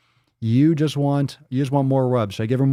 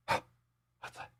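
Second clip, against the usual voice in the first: breathy gasped whisper; 14 dB quieter than usual. Pretend. Excited question to the dog.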